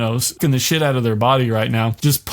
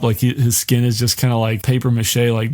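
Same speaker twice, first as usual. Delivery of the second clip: ordinary talk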